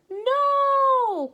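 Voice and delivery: high, loud cry of alarm. Acted. James crying out as Henry pours vitriol, high and loud. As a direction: James cries out to stop him. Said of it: "cried James".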